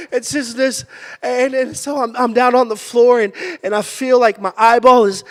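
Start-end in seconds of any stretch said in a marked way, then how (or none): none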